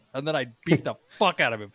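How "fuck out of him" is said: usual speaking voice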